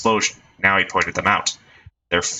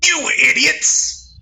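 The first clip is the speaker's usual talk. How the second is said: very high, slow drawn-out shout; hammed-up outraged gang boss; furious yell; 4 dB louder, much slower than usual. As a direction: explode at the idiots. Leader explodes at members.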